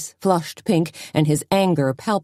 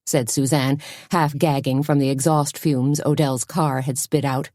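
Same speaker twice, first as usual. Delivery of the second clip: plain reading voice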